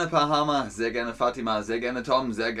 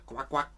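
soft duck quack; 7 dB quieter than usual. Acted. Imitating a duck sound.

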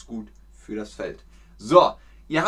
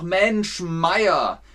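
resigned exclamation; 5 semitones higher, 5 dB louder than usual. Shown as a demonstration. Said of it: "dann sagt man nur noch".

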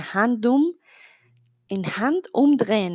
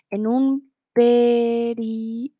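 slow model phrase; slower than usual. Shown as a demonstration. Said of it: "En un periquete decimos".

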